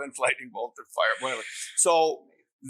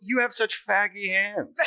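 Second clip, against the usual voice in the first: higher, drawn-out mock mom voice; voiced mother; 7 semitones higher, slower than usual. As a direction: lightly imitate his mother, slowly. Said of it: "And my mom goes".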